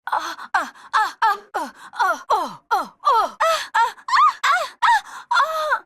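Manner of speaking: rising, high cries of pain. Acted. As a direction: cry out as each hit lands. Hurt in combat.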